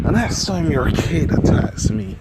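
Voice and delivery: storyteller voicing a loud, threatening ultimatum. Threat voiced in narrated story.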